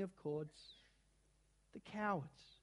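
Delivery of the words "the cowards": sobbing. A narrator's reading. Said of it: "sobbed Susan".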